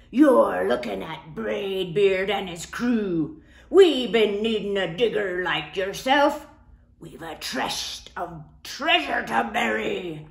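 loud, slow pirate boast; pirate captain. Acted. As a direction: brag about the crew like a captain.

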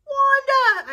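high, loud delighted call; joyful cry. Acted. Flytrap delighted hearing Wanda on the phone, joyful cry. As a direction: call her name with joy. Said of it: "I cried".

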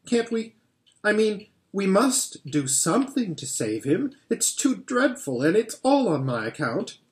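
put-on a girl pleading for Mister Tumnus, distressed; pleading Lucy. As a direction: beg them to help her friend.

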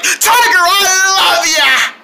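high, ecstatic scream to a tiger; gushing fan scream. Acted. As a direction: squeal it like an adoring superfan. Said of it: "go like".

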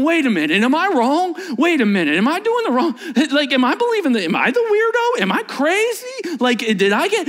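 high, rising worried questions; a spiral of panicked second-guessing; anxious self-doubt. Retold or imitated. Person overwhelmed by the world's noise, anxious doubting voice. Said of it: "that you start going".

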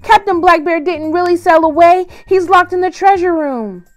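pleading parrot. Acted. Parrot confessing to the crew, urgent. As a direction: blurt out the truth to save himself.

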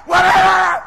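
huge, high mock husband yell; explosive outburst. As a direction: explode like a volcano. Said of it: "making you explode like a volcano".

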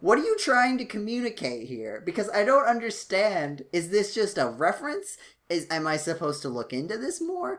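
high, exasperated string of questions; mock bafflement. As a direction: plead for an explanation, hammed-up. Exasperated questions addressed to the show.

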